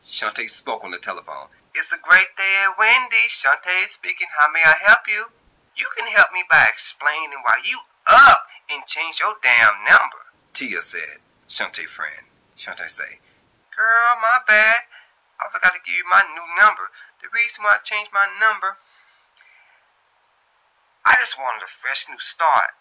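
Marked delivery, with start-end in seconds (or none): 1.8-5.3 higher, louder customer-service voice; bright fast-food phone greeting; cheery phone greeting. Acted. Shantae answering the Wendy's phone, higher and cheery. 5.8-10.3 higher, louder, accusing; scolding friend. Acted. Tia scolding Shantae over her new number, higher and louder. 13.8-22.7 breezy apology. Acted. Shantae brushing off Tia on the phone, higher and louder.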